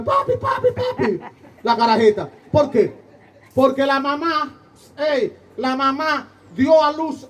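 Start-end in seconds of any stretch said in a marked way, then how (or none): 0.0-1.2 put-on much higher, bright child's call of papi; little girl calling papi. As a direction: sing it out high like a delighted little girl. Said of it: "la carajita me catra, me".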